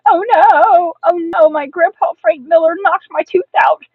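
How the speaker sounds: put-on loud, high child's outcry; frantic, indignant wailing; panicked shouting. A narrator's reading. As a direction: shout the alarm to the whole house. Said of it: "I shouted".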